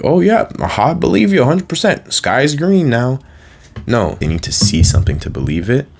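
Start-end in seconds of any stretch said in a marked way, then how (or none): 0.0-3.2 high, put-on voice of a naive believer; mock gullible believer. Mocking someone who believes the sky is green, high and put-on. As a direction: fake easy agreement, hammed up. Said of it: "You're not gonna be like".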